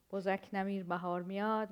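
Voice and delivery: fast proverb in Persian; quoted Persian proverb. Retold or imitated. A Persian saying said quickly as a proverb. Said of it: "we say in Persian".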